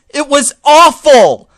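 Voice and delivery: angry. Acted. Angry final verdict.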